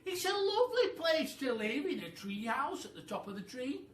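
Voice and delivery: high, loud cheerful monkey voice; a pleased monkey showing off his home; a high, loud, happy monkey. Acted. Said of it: "said Mugglewump".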